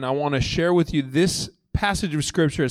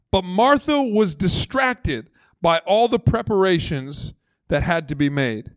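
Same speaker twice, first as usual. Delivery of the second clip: ordinary delivery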